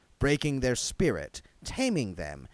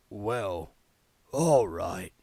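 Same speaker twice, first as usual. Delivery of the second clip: reluctantly; 3 semitones lower, much slower than usual. A narrator's reading. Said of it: "he said reluctantly".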